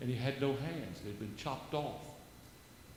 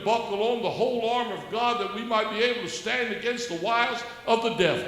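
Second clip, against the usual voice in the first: loud, higher preacher's proclamation; preaching at full force; 9 semitones higher, 9 dB louder than usual. Acted. Exhorting the host and viewers, loud high preaching. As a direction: proclaim it like from the pulpit.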